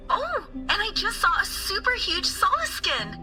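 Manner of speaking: high-pitched, eager news; excited report. Acted. Student excitedly reports a sighting.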